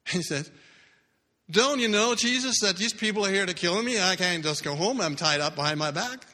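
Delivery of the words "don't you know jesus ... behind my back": loud, high, pleading protest; high desperate plea. Retold or imitated. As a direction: cry out that he can't leave. Captive protesting to Jesus.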